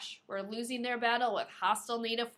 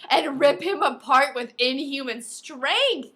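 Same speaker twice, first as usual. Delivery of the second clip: higher, louder, slowed for the gore; dramatic reading; 5 semitones higher, 10 dB louder, slower than usual. Acted. Reading the alien's rampage aloud, slow and dramatic. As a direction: build it up slowly for drama.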